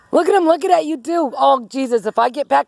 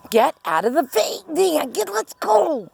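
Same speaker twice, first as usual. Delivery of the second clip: normal talking voice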